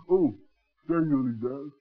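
ordinary delivery

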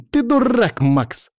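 high shrill yell, stress on ты. Acted. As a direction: shout it, leaning on ты. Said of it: "раздался вопль Эйки".

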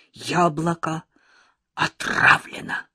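slow, voiceless menacing hiss on отравлено; ominous. A narrator's reading. Sashka frightening Lyolka about her apple, ominous whisper. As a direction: spook them with a sinister half-whisper. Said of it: "зловеще сказал Сашка".

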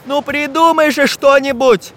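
high-pitched urgent begging; desperate pleading. A narrator's reading. Самоделкин begs his friend to save them, pleading desperately. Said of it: "умолял Самоделкин".

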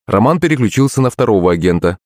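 usual speaking voice

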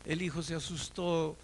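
ordinary delivery

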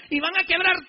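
retold furious priest giving orders; furious command. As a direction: bark the order at the gang leader. Priest retells ordering gang leader.

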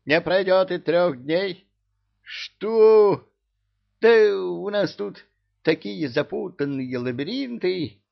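agitated. A narrator's reading. King frets about his tangled labyrinths, agitated. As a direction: get worked up, pitch climbing. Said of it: "заволновался Аддимирьяту".

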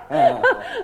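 bright laugh. Acted. Regina laughs at brother.

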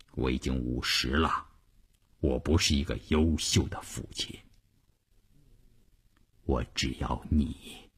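low, hushed, heartfelt confession; quiet, intimate admission; tender husband. A narrator's reading. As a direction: speak softly and low to someone you love. Husband tenderly reassures wife.

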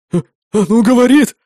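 stammering, loud shocked cry. Acted. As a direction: blurt it out in shock. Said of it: "шокированно произнёс кто-то".

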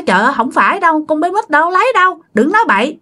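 high, fast protest in a flurry; alarmed protest. Retold or imitated. Aunt protesting to uncle that the child didn't take it. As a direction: wave off the accusation in a fast high rush.

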